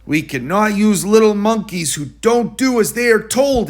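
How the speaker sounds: the director tells the monkey off sharply; angry director. A narrator's reading. Director scolding George for the ostrich trouble, angry. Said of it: "The director was very angry with George".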